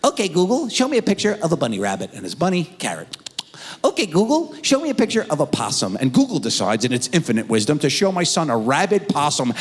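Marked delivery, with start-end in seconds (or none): 0.0-2.1 put-on higher-pitched little boy voice; imitated four-year-old. Young son asking Google for animal pictures, higher child voice. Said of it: "My son is four years old". 3.8-6.0 put-on higher-pitched little boy voice; imitated four-year-old. Young son asking Google for animal pictures, higher child voice. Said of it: "My son is four years old".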